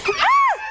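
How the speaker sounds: short high-pitched shriek; shriek on request. Shown as a demonstration. Teacher showing class a shriek, short high shriek. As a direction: let out a quick, high demo shriek. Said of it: "Can you shriek for me".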